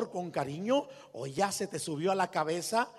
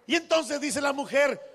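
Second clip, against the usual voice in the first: ordinary talk, nothing marked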